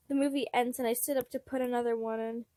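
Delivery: ordinary delivery, nothing marked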